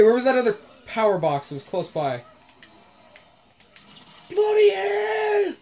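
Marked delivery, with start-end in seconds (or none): 4.3-5.5 "Bloody hell" yelled high and drawn out; startled shout. Real, not acted. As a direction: scream "Bloody hell" in shock. Sudden fright in game.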